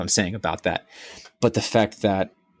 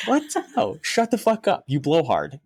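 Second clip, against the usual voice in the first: much higher, dismissive brush-off; mock telling off; 9 semitones higher than usual. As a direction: wave him away in mock scorn.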